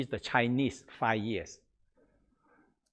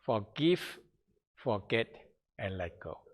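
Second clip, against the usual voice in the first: forgive lifted, let go falling much softer; repeated mantra; 2 semitones lower, 7 dB quieter than usual. Shown as a demonstration.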